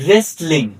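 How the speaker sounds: রেসলিং starting straight on R, no W; silent W. Shown as a demonstration. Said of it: "ডব্লিউ উচ্চারিত হয়নি".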